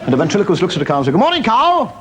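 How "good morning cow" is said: high, hailing call; calling a greeting. Acted. Ventriloquist hailing a cow in a joke, calling out. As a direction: call out over a fence to an animal.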